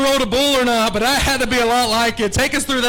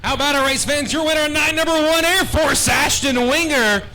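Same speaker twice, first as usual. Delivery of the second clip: announcer call; 6 semitones higher, 3 dB louder than usual. Real, not acted. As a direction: present the winner to the fans. Announcer presents the winner again.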